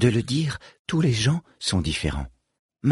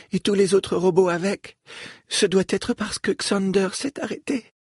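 put-on higher, earnest young girl; a lightly voiced young girl; 10 semitones higher than usual. Falom speaks at length.

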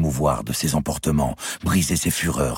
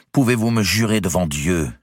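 firm, higher, falling close; determined; 6 semitones higher, 4 dB louder than usual. A narrator's reading. Clémence repeats her demand, determined. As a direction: repeat it, unyielding. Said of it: "répéta Clémence avec détermination".

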